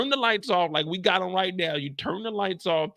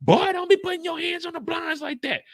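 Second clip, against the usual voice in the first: scolding mama; 8 semitones higher than usual. Retold or imitated. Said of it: "she gonna tell you".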